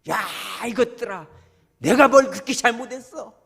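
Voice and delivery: loud, high acted outburst of grievance; venting shout. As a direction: yell it out as if alone on a mountain. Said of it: "산에 가서 소리라도 좀 질러요".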